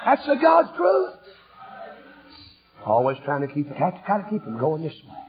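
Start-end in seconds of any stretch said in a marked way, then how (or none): 0.1-1.2 slow, high, loud declaration; fervent sermon shout; preaching shout. Acted. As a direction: declare it to the rafters. Preaching at full force.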